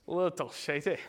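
playful put-on Scottish accent; joking Scottish lilt. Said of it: "I'll get my Scottish accent for that".

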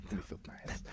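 soft dreamy whisper. Real, not acted. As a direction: echo it in a hush. Echoing the whisper.

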